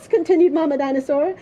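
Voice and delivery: usual speaking voice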